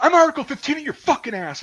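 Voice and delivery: high, loud imitation of a sergeant's threat; drill sergeant. Drill sergeant threatening the private with punishment, yelling. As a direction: yell it like an irate drill sergeant. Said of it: "the drill sergeant goes".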